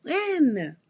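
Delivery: high, fast, whiny mock impatience; impatient client. Reader imitating the client demanding when, high and impatient. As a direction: mimic a client pestering for answers. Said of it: "because you're like".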